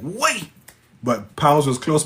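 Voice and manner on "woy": put-on "Woy" in a high squeal, rhyming with boy; Patois exclamation. Shown as a demonstration. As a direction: pitch "Woy" right up high. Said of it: "that's how we say it in Patois".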